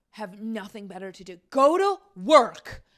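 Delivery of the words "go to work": loud, high, angry order to get a job; real outburst, loud and high; fired-up shout. Real, not acted. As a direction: yell it at the complainers, fired up. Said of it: "see, now I'm fired up".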